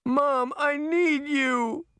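put-on high, slow, tearful girl's voice; tearful plea. A narrator's reading. Daughter begging her mother for help, slow high tearful plea. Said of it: "Allison cried".

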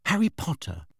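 tentative. A narrator's reading. Hermione naming Harry for the elf, tentative. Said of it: "said Hermione tentatively".

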